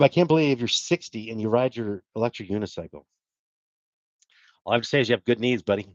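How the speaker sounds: ordinary talk, nothing marked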